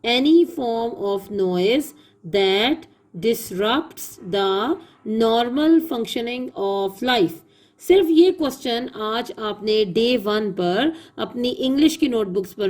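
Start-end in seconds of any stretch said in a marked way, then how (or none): 0.1-7.3 slow dictation. Shown as a demonstration. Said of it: "teacher repeats definition for dictation".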